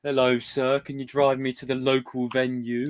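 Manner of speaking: slow, prim posh British voice; mock posh British. Shown as a demonstration. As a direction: put on a stiff posh British accent. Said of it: "We don't go".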